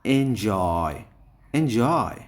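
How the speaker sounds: Enjoy with জয় loud and drawn out; stress on জয়. Shown as a demonstration. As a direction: punch the second syllable of enjoy. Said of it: "এই জয়টা হলে stress দিতে হবে".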